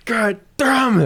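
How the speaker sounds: drawn-out high mock curse; hammed-up exasperation; character dropping the act. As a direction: drag out an exasperated curse, half laughing.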